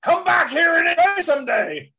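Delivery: high, loud call across the room; mock shout. Host hollering a co-host back to the mic, loud. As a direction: yell at them to come back.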